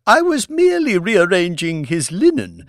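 high, sweet feigned innocence; innocent wife. A narrator's reading. Wife claims innocence after the pillow accusation, high and sweet. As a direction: protest innocence too sweetly.

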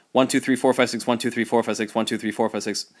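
one to six rattled off fast; fast counting. Shown as a demonstration. Teacher shows students the too-fast six eight count, rushed. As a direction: race through the eighth-note count. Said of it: "I would have to go".

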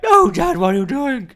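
high, loud mock-alarmed shout; acted protest. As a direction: cry out in exaggerated protest at Dad. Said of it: "I'm like".

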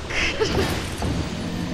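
relieved breathy laugh. Real, not acted. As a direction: laugh off the scare. Laughs after fight.

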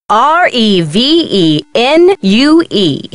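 slow, letter-by-letter spelling; emphatic spelling out. Acted. Curator spelling what the discovery brings, slow and emphatic. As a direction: spell it out slowly, savouring each letter.

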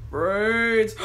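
low, loud groaning zombie moan. Pretend. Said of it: "let me keep acting like a zombie".

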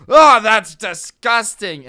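put-on loud, high, slow old man squawk; outraged old man recoiling; squawking disgusted senior. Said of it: "incredulous old people spirit".

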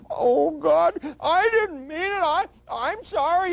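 high, tearful plea; anguished apology. Retold or imitated. Freddie begging Joni's forgiveness, high tearful anguish. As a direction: cry out sorry in anguish.

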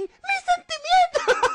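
very high, slow squeal; mock wounded wail. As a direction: wail hammed-up hurt feelings. Hurt Pokémon reply, acted.

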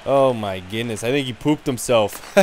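normal talking voice

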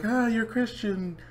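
high mocking voice of a preachy critic. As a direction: whine it like a self-righteous critic. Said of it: "some people are gonna be like".

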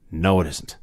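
low, subdued refusal to play along; deadpan it, with no interest at all; done with it. Retold or imitated. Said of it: "was done with the show".

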